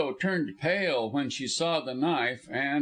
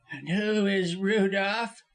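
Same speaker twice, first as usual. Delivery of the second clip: slow, high-pitched question; high lawyer voice, drawn out; scornful challenge; 7 semitones higher, slower than usual. A narrator's reading. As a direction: draw out the question suspiciously. Lawyer challenges her claim.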